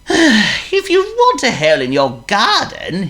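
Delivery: very high squeaky worm. Acted.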